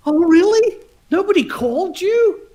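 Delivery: very high, incredulous questioning; retold shock. Bandleader learning nobody phoned the auditionee, mock shocked. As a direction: imitate someone genuinely taken aback. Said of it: "And he was shocked".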